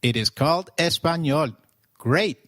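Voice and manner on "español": Español with open "ah" and a ny sound; Spanish pronunciation. Shown as a demonstration. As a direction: model Español the Spanish way for learners. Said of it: "learn how to pronounce it".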